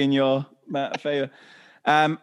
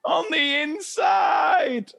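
much higher, very slow wail; mock sobbing kid; 13 semitones higher, much slower than usual. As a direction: drag it out like a wailing child. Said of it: "I was like".

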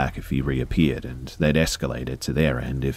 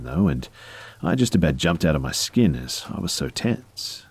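ordinary delivery, nothing marked

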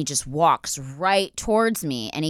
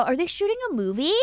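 put-on higher, clueless young girl asking; airheaded girl; 9 semitones higher than usual. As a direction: play the dumb naive newcomer. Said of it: "just such a dumbass".

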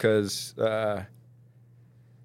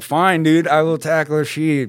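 louder, higher fed-up concession; exasperated giving in; 8 semitones higher, 9 dB louder than usual. Retold or imitated. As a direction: cave in loudly just to end it. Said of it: "I'm like".

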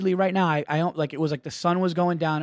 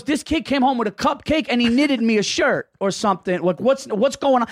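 higher-pitched dad complaint, lightly voiced; indignant dad; 6 semitones higher than usual. Son imitates dad phoning the principal, mildly indignant. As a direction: imitate a baffled dad demanding an explanation. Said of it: "he said to the principal".

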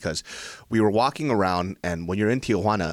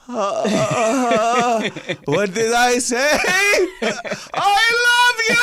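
very high, loud, crying-laughing "I love you"; overacted swooning declaration; hammed-up lovestruck wail; 7 dB louder, slower than usual. Hammed-up reaction to imagined scene.

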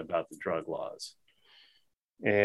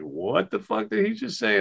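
much higher, incredulous mock reaction; stunned disbelief; 11 semitones higher than usual. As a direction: act out the whole table gasping in shock.

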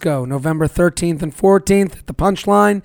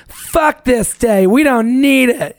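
slow, higher-pitched mock defiance; defiant; 5 semitones higher, slower than usual. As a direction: draw it out like a fed-up single. Voices defiant singles on Valentine's.

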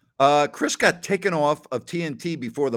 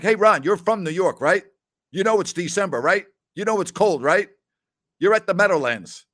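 louder, higher ribbing with "right?" checks; a hint of teasing scolding; 6 semitones higher, 5 dB louder than usual. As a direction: needle a friend who dressed too light. Teasing Ron for freezing at game.

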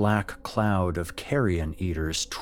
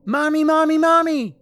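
put-on loud, piercingly high little kid; high-pitched child's cry; much higher child voice; 9 dB louder than usual. A narrator's reading. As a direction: call out for Mommy like a small child. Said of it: "this time with a much higher voice".

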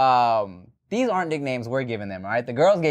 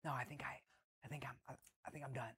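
softer, fast and embarrassed; sheepish mumble; 3 semitones lower, 26 dB quieter, faster than usual. Retold or imitated. Telling co-host what he admitted to her, mumbled fast. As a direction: confess it quickly under your breath.

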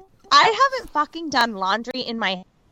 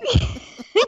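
high, slow, laughing quote; 11 semitones higher, slower than usual. Retold or imitated. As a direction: laugh the words out, pitched up. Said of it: "You went all in".

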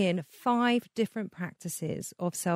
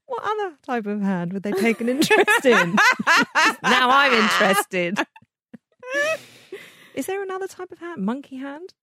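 laughing; 5 semitones higher, 5 dB louder than usual. Real, not acted. Laughing at own script.

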